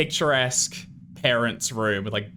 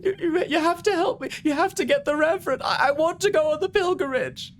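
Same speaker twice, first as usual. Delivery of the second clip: high falsetto, stammering and desperate; frantic, breathy woman begging; breathy pleading. Acted. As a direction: plead through a door in a very high voice. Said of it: "trying to whisper through it".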